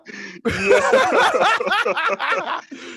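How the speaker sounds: a long, high, loud cackle of laughter; high wild laugh. Real, not acted. Cracking up at his own gross joke to a friend. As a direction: lose it laughing.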